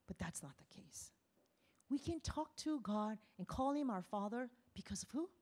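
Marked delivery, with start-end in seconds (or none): 0.1-1.1 soft whispered aside. Real, not acted. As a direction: drop to a hush for weight. Preacher drops to whisper.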